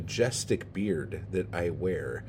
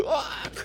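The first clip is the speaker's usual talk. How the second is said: high yelp. Acted. Mock frustrated yelp.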